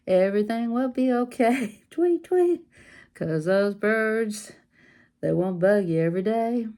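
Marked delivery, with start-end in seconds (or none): 1.9-2.6 chirpy tweets, much higher; bird tweet. Retold or imitated. Crafter imitating a bird for viewers, high chirp.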